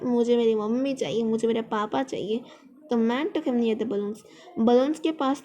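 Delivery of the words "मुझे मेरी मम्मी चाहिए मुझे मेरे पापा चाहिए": ordinary talk, nothing marked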